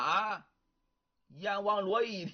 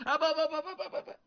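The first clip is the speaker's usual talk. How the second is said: much higher rapid string of no; emphatic refusal; 8 semitones higher, much faster than usual. Acted. Wife cuts off husband's suggestion, high-pitched rapid refusal. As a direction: shoot it down, pitch jumping up.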